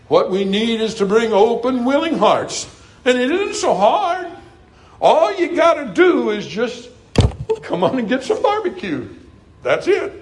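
high, climbing pulpit climax; ringing appeal to the congregation; fervent preaching. Real, not acted. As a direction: preach it up high with eager conviction.

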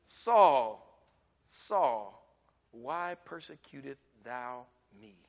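low, hushed, soft voice from heaven; voice of God. Acted. Preacher voicing God calling Saul, low and hushed. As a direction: call his name softly from far above.

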